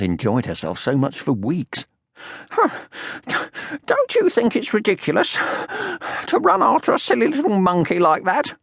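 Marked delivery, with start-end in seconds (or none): 2.5-8.5 panting. Acted. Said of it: "panted Sniff at last".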